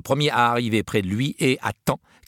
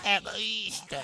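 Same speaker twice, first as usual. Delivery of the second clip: high, soft echoing rat voices; 13 semitones higher, 6 dB quieter than usual. A narrator's reading. Rats repeating their chief, high soft echo. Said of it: "répétèrent les autres rats".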